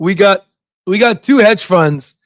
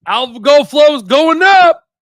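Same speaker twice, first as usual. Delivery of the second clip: excited shout; 7 semitones higher, slower than usual. Real, not acted. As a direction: shout the good news to the crowd. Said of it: "Oh, algo flow's going up".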